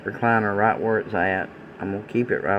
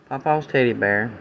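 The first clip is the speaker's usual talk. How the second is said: normal talking voice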